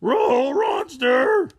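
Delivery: high, loud dog-talk yell; terrified cartoon dog shout; Scooby shout. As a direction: bark out the warning like Scooby. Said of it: "Scooby shouted".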